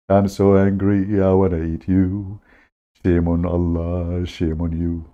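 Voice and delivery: mock fisherman voice. Storyteller voicing the hungry fisherman, slow mock lament. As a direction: ham up a whiny, hungry fisherman who is mad at the missing fish.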